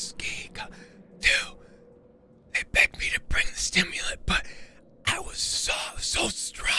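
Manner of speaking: eerie whispered voice; whispering. Acted. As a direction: whisper it like a haunting voice. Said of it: "I hear the whispers".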